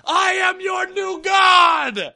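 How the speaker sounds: slow, high booming shout of a self-made god; booming arrogant alien overlord. Retold or imitated. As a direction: bellow it like a loincloth-clad overlord. [name] on air, stripped, burning sticks.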